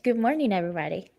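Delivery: usual speaking voice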